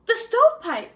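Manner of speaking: high, loud sudden alarm; alarmed gasp. Acted. Third javelina realizing Coyote's plan, loud alarmed cry. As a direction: cry out as the danger dawns. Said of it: "gasped the third little javelina".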